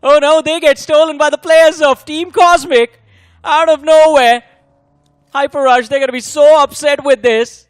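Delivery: rising, loud live play-by-play; excited caster. Real, not acted. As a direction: call it like a shocked commentator. Caster reacts to stolen kills.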